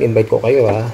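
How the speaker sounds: ordinary talk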